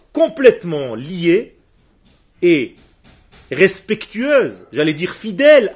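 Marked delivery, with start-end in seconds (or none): none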